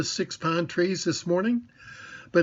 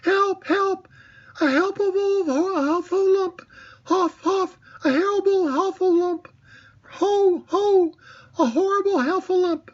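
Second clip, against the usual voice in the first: very high, louder panicked squeal; Piglet crying help; 4 dB louder than usual. A narrator's reading. Piglet sees the Heffalump and cries out in high panic. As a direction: shriek for help like a terrified little pig. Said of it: "was still crying out".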